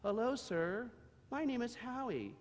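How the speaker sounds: a shy foal introducing himself; little donkey. Acted. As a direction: Howie introducing himself to a stranger.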